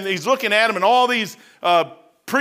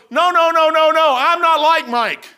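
higher, louder, slow no no no; loud protest; 9 semitones higher, 6 dB louder, slower than usual. Retold or imitated. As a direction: deny it loudly, drawn out. Chrysler protesting loudly.

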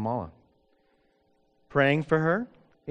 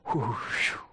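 ordinary delivery

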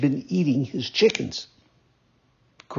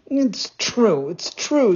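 a touch of tearful pleading, higher; mildly tearful confession, higher voice; in tears; 8 semitones higher, much slower than usual. Acted. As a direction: puppet half-crying, admitting it. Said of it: "Pinocchio in tears".

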